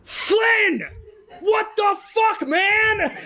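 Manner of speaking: axe wielder yell. Acted. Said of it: "turns and goes".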